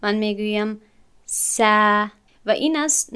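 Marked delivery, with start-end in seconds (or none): 1.2-1.6 س said thin and light, the wrong way; a light س with no emphasis; thin light س instead of ص; light sin. Shown as a demonstration. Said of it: "اگر من این را خالی بخوانم".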